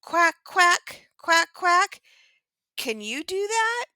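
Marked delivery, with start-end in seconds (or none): 0.0-1.1 high, loud duck quacks; high, loud quacking demo. Shown as a demonstration. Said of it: "they make a sound like this". 1.2-2.0 high, loud duck quacks rising at the end; high, loud quacking with a rising finish. Shown as a demonstration. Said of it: "they make a sound like this".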